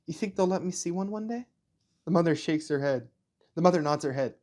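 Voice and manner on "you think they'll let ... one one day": high, lightly voiced child asking; a little boy hoping; hopeful young boy. Ainz asks his mother about seeing a tree, high hopeful child voice.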